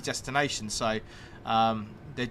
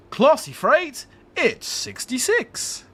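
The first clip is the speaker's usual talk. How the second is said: higher, louder, slow drawn-out bingo call; bingo caller; 9 semitones higher, 5 dB louder, much slower than usual. Shown as a demonstration. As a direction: belt it like a bingo hall caller. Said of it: "in the bingo voice".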